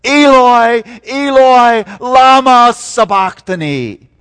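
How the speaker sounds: Eloi, Eloi, lama sabachthani said in Aramaic, high and loud; anguished cry to God in Aramaic. Retold or imitated. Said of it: "in this moment of remarkable anguish".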